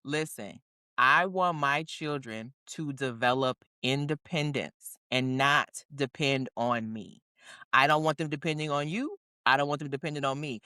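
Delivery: low, measured, firm statement; firm declaration. Retold or imitated. Declaring her rule to her parents.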